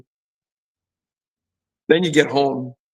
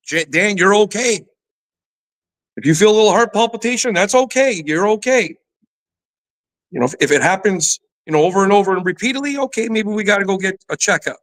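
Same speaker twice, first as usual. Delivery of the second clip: higher, gently reassuring quote of loved ones; 7 semitones higher than usual. Retold or imitated. Family comforting Dan after his surgery, gently reassuring. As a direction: soothe him like a worried family member. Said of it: "what everybody was saying to me".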